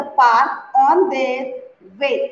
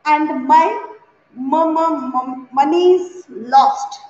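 normal talking voice

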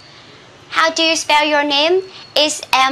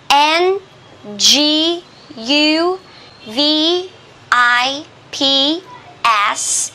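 ordinary talk

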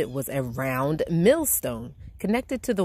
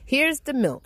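put-on higher, excited boy's call; shouted; 9 semitones higher than usual. A narrator's reading. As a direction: call it out like an eager boy. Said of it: "shouted Jack".

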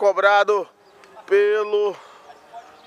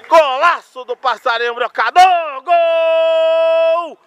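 shouted, jubilant play-by-play; explosive goal commentary; 6 semitones higher, 4 dB louder, slower than usual. Real, not acted. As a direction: keep yelling the celebration. Continued goal celebration.

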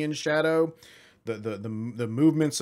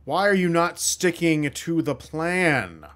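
loud, slow, higher reprimand; drawn-out annoyed cultist challenge; exasperated scolding; 4 semitones higher, 5 dB louder, slower than usual. Acted. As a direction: demand answers from a wayward underling. Cultist scolds a supposed comrade.

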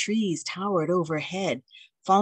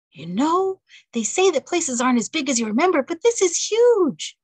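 higher, louder, wide-eyed wonder; awed young wife; 10 semitones higher, 5 dB louder than usual. Acted. April gazing round the grand foyer, higher and awed. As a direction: let the size of the place amaze her.

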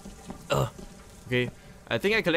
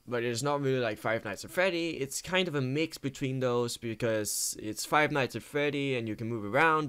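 ordinary talk

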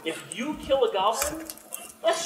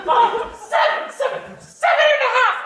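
goblin husband; 9 dB louder than usual. Acted. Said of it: "my wife comes out".

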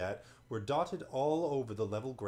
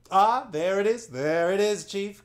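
much higher and louder fawning shout; eager chorus cry; 9 semitones higher, 12 dB louder than usual. Acted. Monopods flattering their chief, loud eager cry. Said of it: "cried all the others".

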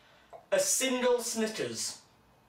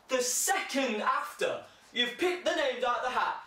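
ordinary delivery

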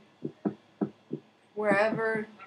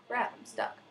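ordinary delivery

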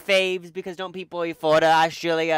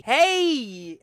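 higher, drawn-out corny greeting; waiter's greeting; 6 semitones higher, 3 dB louder than usual. Acted. Waiter greeting diners at the table, corny greeting. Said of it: "go up to the table and say".

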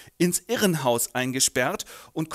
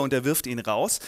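ordinary talk

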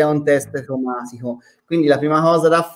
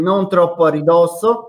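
ordinary talk, nothing marked

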